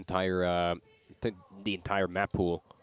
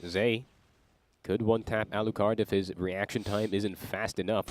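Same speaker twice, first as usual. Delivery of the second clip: normal talking voice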